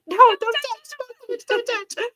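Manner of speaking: very high-pitched, frantic mock warning; imitated alarm. Grandmother stopping toddler touching glass, high-pitched mock alarm. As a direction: squeal it as a hurried retold warning.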